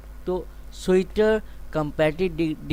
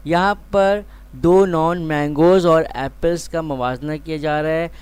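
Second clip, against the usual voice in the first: ordinary talk, nothing marked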